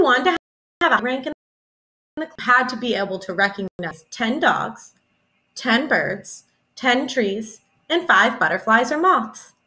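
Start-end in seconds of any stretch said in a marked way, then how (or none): none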